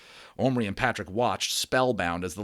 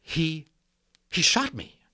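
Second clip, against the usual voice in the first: high, stunned repeat; shocked; 7 semitones higher, 4 dB louder, slower than usual. Acted. Patrick, stunned, repeats that he was shot, shocked. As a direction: say it still in shock. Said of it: "in a shocked voice".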